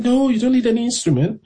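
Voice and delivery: won't take no, lightly voiced; insistent old man. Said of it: "And he's like".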